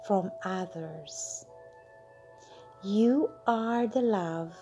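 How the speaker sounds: normal talking voice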